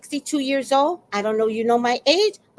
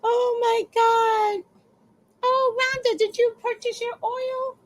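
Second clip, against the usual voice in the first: high-pitched cooing; 12 semitones higher than usual. Real, not acted. Streamer asking viewer Rhonda about her oil, high singsong.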